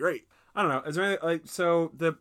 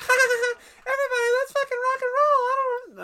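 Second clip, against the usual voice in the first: much higher, louder, hammed-up fairy cackle; cackling rocker fairy; 7 dB louder than usual. Podcaster mocking the Green Fairy's line, high hammed-up rocker squeal. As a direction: shriek it like a giddy rock fairy. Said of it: "she's like".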